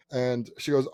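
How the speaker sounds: ordinary delivery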